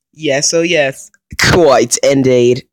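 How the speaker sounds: loud, slow posh gloat; a hammy villainous gentleman. Pretend. Gloating at difficulty change.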